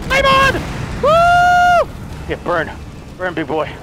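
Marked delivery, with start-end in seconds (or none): 0.1-1.9 very high, loud whoop; triumphant battle cry. Real, not acted. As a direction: yell it like a superhero catchphrase. Firing flame rounds in triumph.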